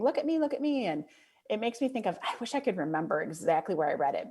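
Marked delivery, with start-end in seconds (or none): 0.0-0.9 attention-seeking mimic.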